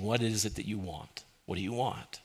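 soft, quiet, tender asking; God asking gently. Acted. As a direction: ask softly, the way God invites.

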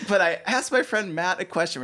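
ordinary delivery

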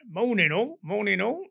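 loud, high, chirpy Cockney hello; a breezy Cockney pilot breezing in; cheery Cockney greeting. Retold or imitated. Cockney pilot bursts into the office, cheery greeting. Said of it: "he was a Cockney".

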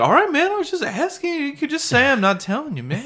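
high-pitched hurt protest; whiny high voice. Retold or imitated. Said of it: "I was like".

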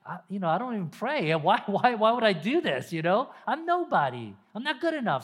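mock helpless protest; self-doubting excuse. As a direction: protest you're not worthy. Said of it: "you're like".

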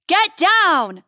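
high, loud urgent bellow; bellowed warning. A narrator's reading. Guard warning everyone of incoming fire, bellowing. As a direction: bellow it as a sudden alarm. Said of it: "bellows Horatio's guard".

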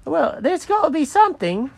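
high-pitched, desperate character voice; pleading cat. Acted.

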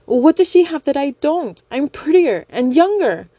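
high, loud jealous whine; high jealous plea. Acted. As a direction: beg jealously, high-pitched. Young woman pleading jealously.

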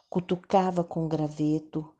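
usual speaking voice

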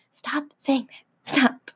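hushed, whispered plea to stop; exasperated whisper. Acted. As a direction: whisper it, at the end of your patience. Fed up with being nagged.

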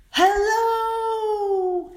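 high, loud hollered greeting; hollering. Acted. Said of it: "he hollered".

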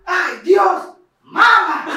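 high, loud mock panic calling mom; hammed-up fake alarm. Covering his own mess, calling his mom in staged alarm. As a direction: overact the shock, playing innocent. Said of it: "ya hablo como que bien actuado".

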